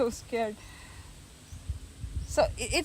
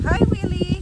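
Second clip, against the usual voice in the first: calling out; 9 semitones higher, 9 dB louder than usual. Real, not acted. Greets Willie while cooking.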